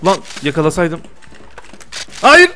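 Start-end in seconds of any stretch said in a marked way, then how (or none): 2.2-2.5 high, loud cries of no; panicked shouting. Real, not acted. Streamer yelling no as his rival closes in, high panicked shout. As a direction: yell in panic as you're caught.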